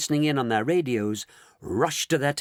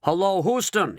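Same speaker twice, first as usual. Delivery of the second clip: high, urgent call into the mic; 8 semitones higher, 3 dB louder than usual. A narrator's reading. Astronaut calling Houston, high urgent cry. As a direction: hail ground control urgently. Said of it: "he cried into the mic".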